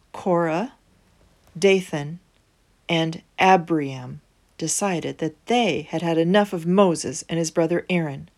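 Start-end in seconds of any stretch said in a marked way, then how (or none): none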